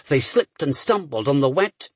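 plain reading voice

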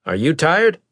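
stern captain voice. Acted. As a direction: voice the captain with authority. Maddox voiced.

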